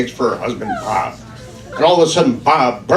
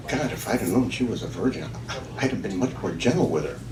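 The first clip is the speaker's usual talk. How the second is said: hushed inner thought; 3 semitones lower, 9 dB quieter than usual. Retold or imitated. Man's private thought in bed.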